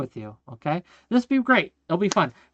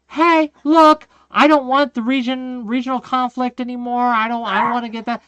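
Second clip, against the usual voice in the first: higher, lightly voiced reasonable objector; mock reasonable voice; 6 semitones higher, 4 dB louder than usual. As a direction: play the calm critic, a bit mocking. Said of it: "you can say".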